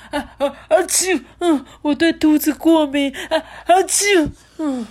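sneezing king. Acted. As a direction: act out an allergic king sneezing. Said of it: "结果国王就".

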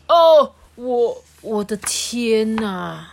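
loud stammered gasp, then a slow, drawn-out cry of amazement; astonished gasp. Acted. Dr. Boom to the goldfish as the train starts, loud amazed gasp. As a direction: gape at the moving train, stretching the words.

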